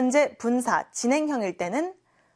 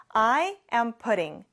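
"I am putting" said very slowly, word by word; slow drill; much slower than usual. Shown as a demonstration. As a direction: stretch "I am putting" out for learners. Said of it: "같이 해볼까요". (아주 천천히 또박또박)

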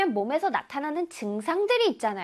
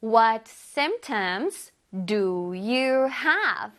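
very slow, careful English; slow teacher demo; slow model reading; 3 semitones lower, much slower than usual. Shown as a demonstration. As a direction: spell out each word slowly for students. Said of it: "선생님이랑 해볼게요".

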